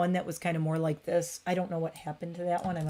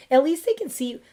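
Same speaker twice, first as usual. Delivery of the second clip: excited; 9 semitones higher, 7 dB louder than usual. Real, not acted. Excited talk to cat.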